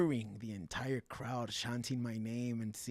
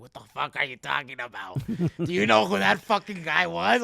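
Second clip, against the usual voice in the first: retold coach, raised and incredulous; loud, higher mock scolding; outraged coach; 5 semitones higher, 11 dB louder than usual. Coach tells fighter who he just sparred, outraged.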